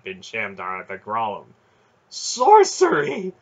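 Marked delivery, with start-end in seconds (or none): 2.1-3.3 very high, loud, drawn-out cry; aghast high-pitched outburst; horrified gasp. A narrator's reading. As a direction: gasp it out in horror. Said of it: "he gasped".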